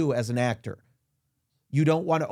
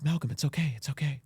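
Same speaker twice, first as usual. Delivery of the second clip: softer soothing reassurance; calm a partner after a nightmare; soothing girlfriend; 5 dB quieter, faster than usual. Retold or imitated. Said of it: "my girlfriend's like".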